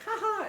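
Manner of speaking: high-pitched mock "ha ha"; imitated playful laugh. Coach showing how he kicks students lightly, imitated playful laugh. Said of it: "and I, like, laugh".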